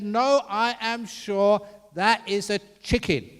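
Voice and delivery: high, loud mock-indignant wife; acted heated contradiction; emphatic insistence. Wife's escalating acted quarrel.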